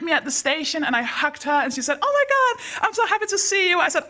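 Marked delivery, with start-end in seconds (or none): 2.0-3.8 high, gushing hello to a friend; mock-squealing reunion; delighted greeting. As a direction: imitate an overjoyed friend, pitched way up.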